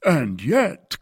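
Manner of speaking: high, loud objection; exclaimed. A narrator's reading. Porthos objects to D'Artagnan's prudence, exclaiming. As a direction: burst in with a protest. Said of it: "cried Porthos".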